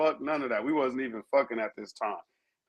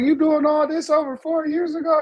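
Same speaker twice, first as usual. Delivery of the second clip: mad cousin; 13 semitones higher, 5 dB louder than usual. Retold or imitated. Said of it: "She like".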